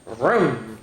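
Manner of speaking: ordinary delivery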